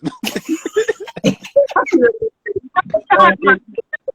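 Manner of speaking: a long, loud, high burst of laughter. Real, not acted. Laughing at his own story about his ex, loud high laugh.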